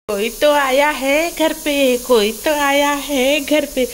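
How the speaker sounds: slow, drawn-out teasing announcement; playful singsong. Pretend. Mother noticing someone came home, playful singsong. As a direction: stretch each phrase like a little chant.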